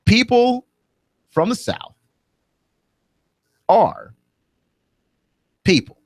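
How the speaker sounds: pointed, deliberate pauses between People, from the South, are and people; emphatic pauses. Real, not acted. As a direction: spell it out slowly, word by word. Driving home the point.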